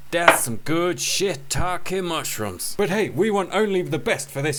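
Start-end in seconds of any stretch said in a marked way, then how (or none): 0.1-2.7 very slow, relishing delivery; slow savouring drawl. Pretend. Cook savouring the smoothie, slow drawl. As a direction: savour each word slowly.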